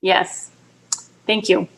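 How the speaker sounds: ordinary talk, nothing marked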